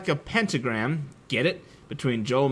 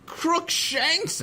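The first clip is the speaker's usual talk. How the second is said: high shout. A narrator's reading. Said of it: "I shouted at him".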